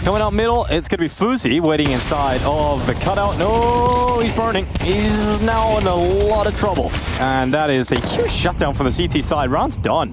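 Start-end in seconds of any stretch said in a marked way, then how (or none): 0.0-6.9 excited play-by-play. Acted. Caster calling the round live, rising excitement. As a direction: call the fight as it swings.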